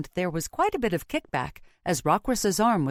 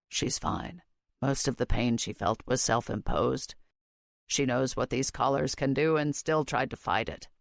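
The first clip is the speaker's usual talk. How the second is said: low, soft, calm male voice; low Rockruss voice; 5 semitones lower, 4 dB quieter than usual. A narrator's reading. Rockruss character voice.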